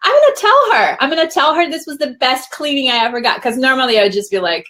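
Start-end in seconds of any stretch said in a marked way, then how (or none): 0.0-3.4 higher, bright-voiced rush of decision; eager resolve. Retelling her dentist visit, a higher excited resolve. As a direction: pump yourself up, pitched up. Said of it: "I was like".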